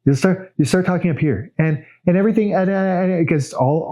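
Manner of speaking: head voice demo. Shown as a demonstration. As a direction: lift the voice up into the head.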